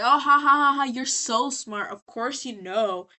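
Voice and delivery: loud fake ha-ha, then slow dripping sarcasm; mock-laugh, then drag out the fake praise; sarcastic mock laugh. Angel retorting to Husk about being smart, sarcastic.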